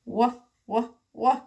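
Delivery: Wha said as repeated wa sounds; a child showing the w sound. Shown as a demonstration. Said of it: "W sounds like wa".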